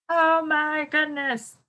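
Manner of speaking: slow, high oh my goodness; amazed. Real, not acted. Teacher marvelling to the class at the one-legged sleep, slow and high. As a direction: savor the astonishment slowly. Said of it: "That's a wow fact to me".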